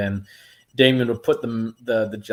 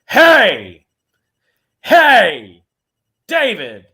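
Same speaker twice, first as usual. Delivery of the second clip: loud, higher holler for attention; Kane Hodder calling out; 8 semitones higher, 13 dB louder, much slower than usual. Retold or imitated. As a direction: holler a name across a crowded room. Kane hollering across signing room.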